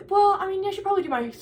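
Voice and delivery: high, loud, hurried excuse; flustered excuse. Retold or imitated. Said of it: "And I'm like".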